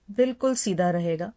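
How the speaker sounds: usual speaking voice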